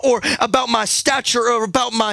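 loud, high preacher's cadence over music; preaching at full force. Acted. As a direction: preach it out, rising over the band.

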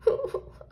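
acted high sobbing; short high whimper of crying; tearful sob. A narrator's reading. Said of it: "she said tearfully".

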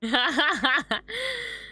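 high, bursting laugh at the screen; real laugh. Real, not acted. Reacting to the friendly rivalry, a delighted laugh.

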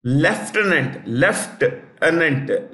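"Lieutenant" slowly said British-style as lef-tenant; slow British "leftenant" for "Lieutenant"; British pronunciation. Shown as a demonstration. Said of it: "is the British pronunciation".

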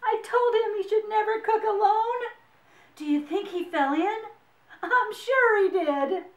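high, loud story-voice alarm. A narrator's reading. Friends think Max fell in the soup, alarmed. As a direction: raise the voice in worried alarm.

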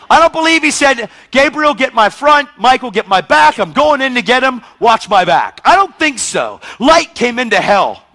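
loud, high, driving sermon; preaching at full force. Acted. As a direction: preach it out to the whole room. Preacher builds on Jesus descending.

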